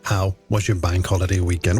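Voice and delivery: normal talking voice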